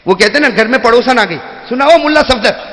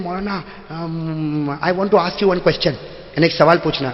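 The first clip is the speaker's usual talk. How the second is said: put-on slow, softer British-tinged young man; young English-speaking man; 5 semitones lower, 8 dB quieter, much slower than usual. Young man asking the maulana, slow and soft. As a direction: drawl it like a Londoner.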